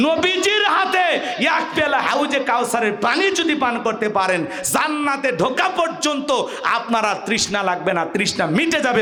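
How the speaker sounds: high, ringing sermon delivery; impassioned preaching. Real, not acted. Preacher promises the crowd the Prophet's cup, impassioned preaching. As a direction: preach it at full force to the crowd.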